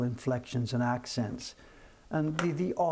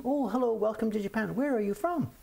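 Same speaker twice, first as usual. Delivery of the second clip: much higher, bright welcome question; Japanese shopkeeper greeting; 9 semitones higher than usual. Retold or imitated. Imagined Japanese shopkeeper welcoming foreign tourists, high polite. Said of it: "the Japanese person would ask them".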